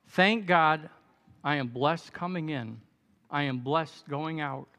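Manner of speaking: usual speaking voice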